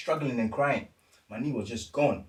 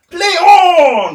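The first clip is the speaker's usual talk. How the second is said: mock shout, high and loud with a laugh; referee shouting; 19 dB louder than usual. Referee shouting play on while the player screams. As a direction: yell it like a careless ref. Retold referee ignoring injury.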